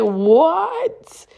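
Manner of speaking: very high, rising acted what; incredulous. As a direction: stretch it into an astonished question. Reacting to partner's denial.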